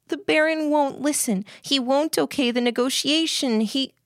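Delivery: put-on high-pitched, anxious girl; pleading Lillian. Lillian begs, near breaking.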